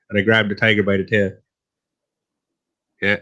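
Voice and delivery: ordinary talk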